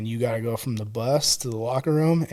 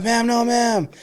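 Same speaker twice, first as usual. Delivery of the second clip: high-pitched recruit answer; mock dutiful reply, pitched up; nervous recruit; 9 semitones higher, slower than usual. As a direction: imitate a recruit sounding off to a DI. Retold recruit answering a yelling deputy.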